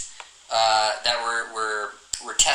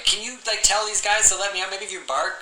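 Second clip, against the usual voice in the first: lightly voiced dog, higher pitch; pleading dog; 9 semitones higher than usual. Dog asking another to get it let out, higher pleading.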